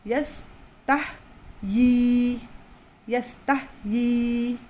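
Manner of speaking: यस्ताहयी slowly with the ता held long; slow recitation. Shown as a demonstration. Teacher reciting यस्ताहयी slowly for the class. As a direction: draw out यस्ताहयी syllable by syllable. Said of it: "दो हरकत जितना खींच कर पढ़ना है".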